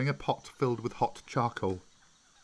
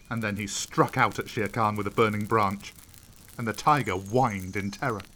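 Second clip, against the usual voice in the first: ordinary delivery, nothing marked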